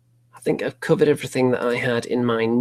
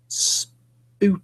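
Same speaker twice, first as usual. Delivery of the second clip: high, eerie ghost-story voice; spooky voice; 9 semitones higher than usual. Acted. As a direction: say it like a hammy ghost. Mock-spooky word for the story.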